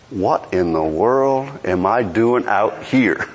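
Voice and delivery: low, slow, drawn-out disbelief; dawning alarm. Acted. Peter looking around at the waves, slowly realizing his folly. Acting Peter's doubt on the water.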